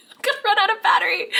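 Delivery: fast, high laugh-cry; high, rushed teary giggle; tearful laughing rush. Real, not acted. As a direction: laugh through the tears quickly. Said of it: "get it together, woman".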